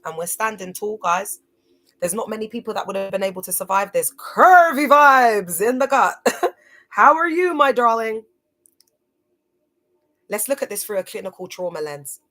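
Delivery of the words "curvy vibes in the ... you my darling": hammed-up playful greeting. Greeting a viewer with fondness.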